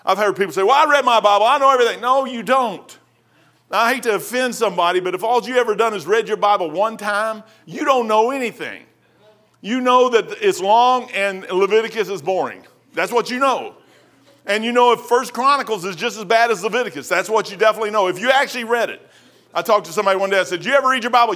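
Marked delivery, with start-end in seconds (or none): 0.6-2.0 loud, high mock of a smug reader; mocking know-it-all. Preacher mocking people who claim to know the Bible, loud and high. 2.0-19.0 loud, high, driving preacher voice; preaching at full force. Acted. Preacher scolding once-through Bible readers, loud and high. 20.6-21.3 loud, high challenging question; pointed challenge. Retold or imitated. Preacher retelling how he challenged a man, loud and high.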